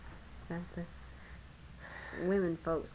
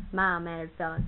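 ordinary talk

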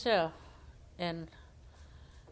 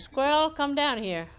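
calling up; 6 semitones higher, 6 dB louder than usual. Acted. Rabbit calls squirrel from tree.

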